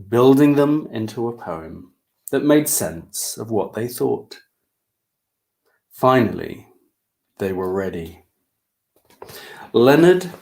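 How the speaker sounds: ordinary delivery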